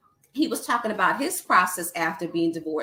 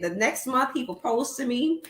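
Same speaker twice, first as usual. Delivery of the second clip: usual speaking voice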